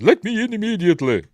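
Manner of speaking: high, loud, haughty mock demand; uppity demand. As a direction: play her as an indignant lady barking orders. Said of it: "she gets all uppity".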